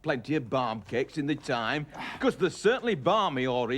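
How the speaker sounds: put-on a light Lancashire accent, cheeky; Ramsbottom. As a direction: tease them in broad Northern dialect.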